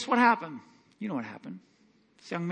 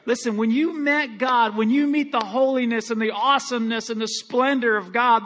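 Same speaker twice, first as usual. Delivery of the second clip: normal talking voice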